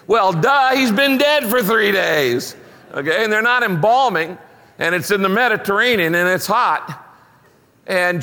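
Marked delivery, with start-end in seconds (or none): 0.1-2.6 loud, high mock-obvious duh; sarcastic, obvious jab. Real, not acted. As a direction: roll your eyes through it, louder and higher. Sarcastic aside on Lazarus stinking.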